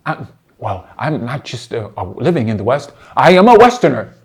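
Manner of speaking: low, hesitant start swelling to loud declaration; low mimicry building to a proud boast; mock self-important reply. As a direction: imitate a pompous answer, punching the last claim. Mimics his opponent's answer.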